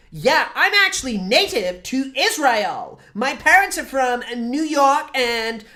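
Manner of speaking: high, slow hammed-up American voice; mock American settler. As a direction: sneer it like a smug settler caricature.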